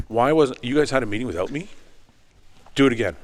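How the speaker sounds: mock-furious boss who was left out; indignant outburst. Boss demanding why he wasn't called, indignant. Boss angry at missed meeting.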